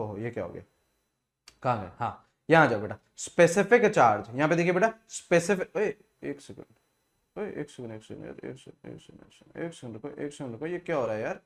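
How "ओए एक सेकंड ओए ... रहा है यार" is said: muttering to himself. Real, not acted. Screen glitch, muttering to himself.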